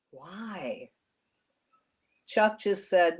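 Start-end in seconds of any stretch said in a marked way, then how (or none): 0.1-0.9 soft breathy whisper, barely voiced. A narrator's reading. Young man whispering to the wounded cop, hushed. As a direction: lean in and breathe the question. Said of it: "leaned over the wounded cop and whispered".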